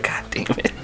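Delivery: breathless whispered god damn it; whispered curse. Retold or imitated. Watching the man steal pepper, mutters it under breath. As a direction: whisper it in disbelief. Narrator reacts watching theft.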